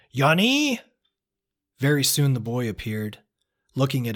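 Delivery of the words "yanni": high, loud sharp call into the house. A narrator's reading. Mother calls her son from inside the house, sharp. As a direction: call the boy in, quick and sharp. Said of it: "She called into the house sharp and quick".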